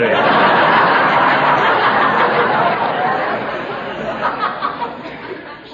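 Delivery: long high rolling giggle; a delighted wheezing laugh rising up high. Acted. Gildersleeve tickled by his own joke, long giggle.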